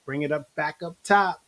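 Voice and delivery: ordinary talk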